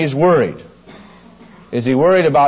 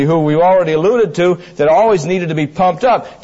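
ordinary talk, nothing marked

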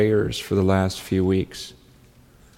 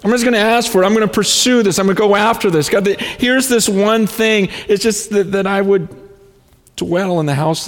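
high and loud, pouring out longing; fervent prayer; 10 semitones higher, 5 dB louder than usual. Retold or imitated. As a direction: plead with God, swept up and passionate. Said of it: "He says".

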